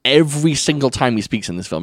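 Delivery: usual speaking voice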